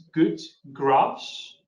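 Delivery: very slow "Good Graphs", each word apart, rising at the end; "Good Graphs" drawn out for clarity; repeating the name. Real, not acted. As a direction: give "Good Graphs" at dictation speed. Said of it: "if you could repeat the name".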